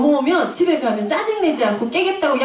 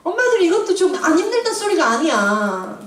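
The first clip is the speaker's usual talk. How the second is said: casual blunt; 6 semitones higher than usual. Real, not acted. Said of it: "죄송해요".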